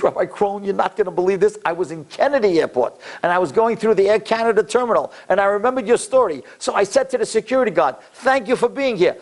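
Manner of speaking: high, eager retelling; can't wait to share the news; excited. Retold or imitated. Excited man retelling, high pitch.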